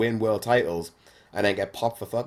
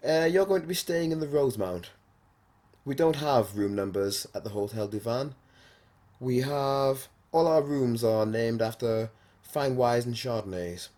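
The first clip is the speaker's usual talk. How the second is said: mock-refined, higher hotel-staff voice; polite receptionist; 4 semitones higher than usual. Hotel guy explaining room names to a guest, politely. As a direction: imitate a receptionist proudly presenting the rooms. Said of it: "He goes".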